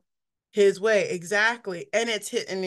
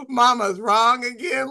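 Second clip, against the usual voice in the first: put-on Water Boy line; 4 dB louder than usual. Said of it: "part where he's like".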